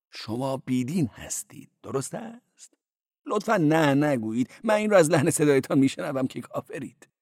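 high-voiced cleric. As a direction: play the cleric high and quick.

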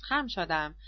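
ordinary delivery, nothing marked

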